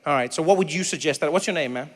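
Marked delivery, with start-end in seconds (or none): none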